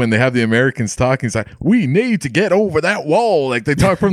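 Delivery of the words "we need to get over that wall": high-pitched mock Texan; Texas accent. Americans talking in a German film, mock Texas accent. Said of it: "like, from Texas and all this".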